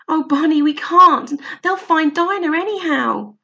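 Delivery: put-on high, loud scared girl's plea; frightened protest. Lou refusing to climb across, frightened plea. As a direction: plead in fear.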